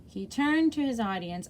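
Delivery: plain reading voice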